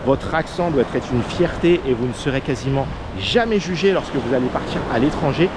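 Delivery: ordinary talk, nothing marked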